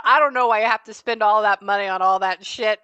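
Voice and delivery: loud exasperated griping; complaining. Retold or imitated. Spirit griping about money spent on her, loud complaint. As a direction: gripe loudly about the waste. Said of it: "She's complaining about".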